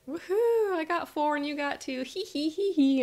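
high whoop into a teasing giggle; gleeful child. Retold or imitated. Child beats sister at diving, gleeful gloating. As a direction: crow like a little kid who's winning. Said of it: "And I go".

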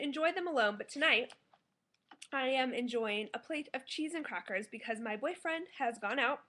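ordinary delivery

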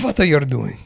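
hammed-up German superhero challenge; German-accented Batman. Repeating the Batman joke for friends, German accent. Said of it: "but with that accent".

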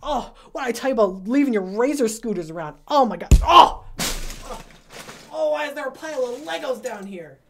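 hammed-up yelps of pain; overacted ouch after stubbing a toe; stubbed toe. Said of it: "jeez, I just stubbed my toe".

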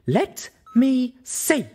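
very slow, high, firm demand; insist, no arguing. Acted. Velvet Remedy demands to see the inventory, slow and firm. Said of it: "she demanded".

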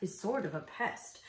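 soft, muted grumble; murmuring. A narrator's reading. Moose complains about the bird, murmuring. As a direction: grumble softly to yourself. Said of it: "murmured Thidwick".

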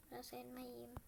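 normal talking voice

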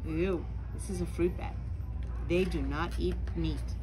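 usual speaking voice

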